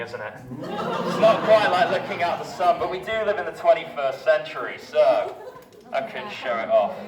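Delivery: ordinary talk, nothing marked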